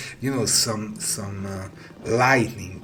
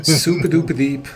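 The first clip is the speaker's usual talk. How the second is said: higher, louder and fast, egging it on; playful excited push; 6 semitones higher, 9 dB louder, faster than usual. Real, not acted. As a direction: hype it up with a grin. Playful topping of bow.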